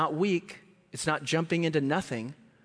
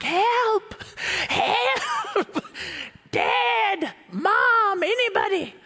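put-on high frantic child's cries for help; crying for help; much slower than usual. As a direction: call out like a scared kid stuck in a tree. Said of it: "So I start crying out".

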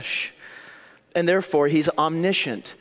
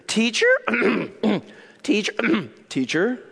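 higher, cracking voice with throat-clearing; nervous voice crack; 6 semitones higher than usual. Acted. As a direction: act rattled, failing to get the word out. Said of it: "you can hear his voice crack".

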